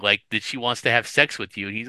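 ordinary talk, nothing marked

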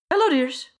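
put-on high, sugary old granny greeting; sweet old lady. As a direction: coo it like a doting grandmother. Granlin greets the party sweetly.

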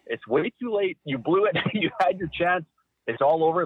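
teasing gloat. Retold or imitated. Teasing wife after she blew it.

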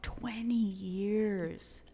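slow awed whisper. Real, not acted. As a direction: breathe it out slowly. Solemn aside about nine eleven.

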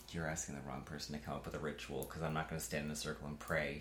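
quiet. Retold or imitated. Retold reply, very soft.

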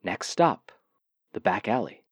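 flat bus announcement voice; robotic transit announcer voice. Retold or imitated. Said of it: "the robotic voice of the bus announcement".

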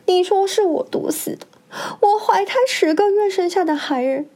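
high, tearful indignant pleading; frightened protest. A narrator's reading. Accused widow confronts the first wife, frightened and tearful. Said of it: "海棠吓出一身冷汗".

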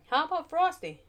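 put-on high, eager child's question; little girl suggesting a name. A narrator's reading.

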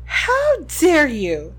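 shouted, slow and stretched; furious shout while running; yelling while running away. A narrator's reading. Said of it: "the figure shouted as she ran".

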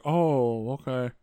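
quiet shrug of an okay; flat indifferent oh okay. Retold or imitated. Mother hears the news, quiet indifferent okay. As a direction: let it trail off, uncaring. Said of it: "She's like".

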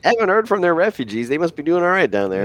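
high-pitched, breezy mock reassurance; comic high voice, pretending all is well; mock obliviousness. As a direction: play clueless officials, voice climbing high. Mock officials shrugging off forgotten refugees.

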